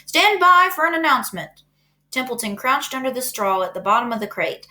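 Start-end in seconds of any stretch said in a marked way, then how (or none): 0.0-1.6 higher, loud loudspeaker boom; booming announcer. A narrator's reading. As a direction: boom it over the fairground. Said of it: "boomed the voice of the loudspeaker".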